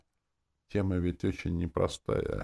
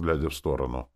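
usual speaking voice

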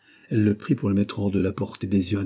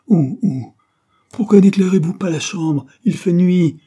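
high, loud frightened cry; alarmed old man who cannot see; panicked; 11 semitones higher, 10 dB louder than usual. Acted. Dying man panics, going blind.

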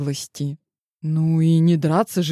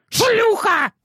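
furious insult. A narrator's reading. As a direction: hurl the slur with hate. Fiancée slaps and insults.

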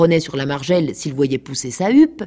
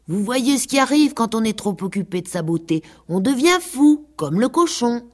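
higher preachy hen voice; lecturing hen; 7 semitones higher than usual. A narrator's reading. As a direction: scold the girls like a fussy old hen. Hen warns the girls.

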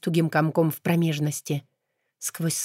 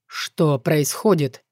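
displeased king; 2 semitones lower, 3 dB louder, much slower than usual. Acted. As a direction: ask it slowly, displeased. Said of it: "Недовольный голос короля". (недовольный голос короля)

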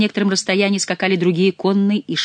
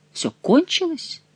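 slow, much higher, strange echo; stunned echo; 11 semitones higher, slower than usual. Acted. King echoing the news in slow disbelief. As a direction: repeat it in disbelief. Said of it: "переспросил король".

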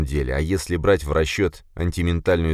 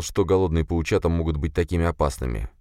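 ordinary delivery, nothing marked

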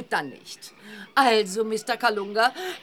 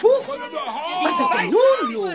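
startled, drawn-out alarm, higher; 7 semitones higher, 4 dB louder, slower than usual. Acted. Reporter startled as villagers turn angry. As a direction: caught off guard by a sudden uproar. Crowd turns hostile suddenly.

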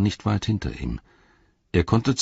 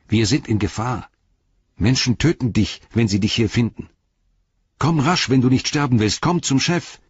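higher-pitched, pressing plea; urgent warning; 6 semitones higher, 4 dB louder than usual. A narrator's reading. Frodo warning Gollum that archers may kill him, urgently. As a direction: build in urgency toward the command to come.

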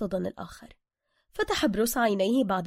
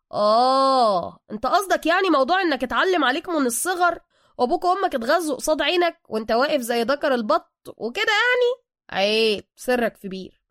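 knowing understanding; 4 semitones higher, 8 dB louder than usual. A narrator's reading. Suka promising to keep Bruce's secret, loudly understanding. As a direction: show that you've got it completely, a bit too eagerly. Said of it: "سوكا متفهما".

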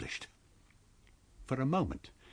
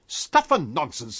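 scoffing; 3 semitones higher, 7 dB louder, slower than usual. Acted. Invisible man scoffs.

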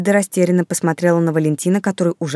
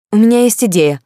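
higher, louder burst of inspiration; bright-idea outburst, pitched up; sudden exclamation; 6 semitones higher, 6 dB louder than usual. A narrator's reading. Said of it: "вдруг воскликнула Кристин".

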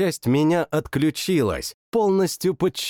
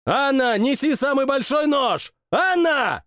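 higher shouted orders; urgent yelling; 11 semitones higher than usual. A narrator's reading. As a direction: yell orders to the cook. Hero yells to cook. (кричит кухарке)

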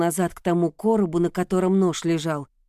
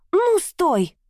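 much higher, desperate shout; shrill demand; 12 semitones higher, 3 dB louder than usual. A narrator's reading. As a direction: scream it to freeze him. Heroine halts husband, knife at throat.

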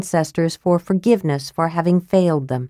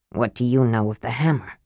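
softer, lower, guarded question; 6 semitones lower, 5 dB quieter than usual. A narrator's reading. Sturm asks Raistlin about the hammer, softer and lower. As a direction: ask it low and close. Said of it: "he asked in a low voice".

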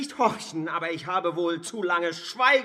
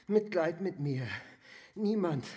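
usual speaking voice

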